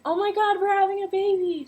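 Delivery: put-on high, excited quote of the boyfriend; thrilled at the news. Boyfriend hearing they're having a baby, excited. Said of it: "And he's just like".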